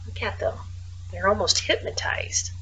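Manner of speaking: soft whispered aside. Real, not acted. Pointing out the entranced mice, whispered. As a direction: hush so you don't wake them.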